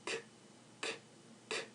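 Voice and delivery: K as a bare puff, no vowel; unvoiced K. Shown as a demonstration. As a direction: say each K with no vibration in the throat. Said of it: "Say the sa-, the K sound".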